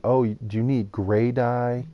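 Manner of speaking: ordinary talk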